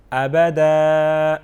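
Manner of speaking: ابدا ending stretched one alif; long alif ending. Shown as a demonstration. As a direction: draw out the final اَ of ابدا. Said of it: "ایک الف کے برابر کھینچ کے پڑھیں گے". (ابدا کو آخر میں ایک الف کے برابر کھینچیں)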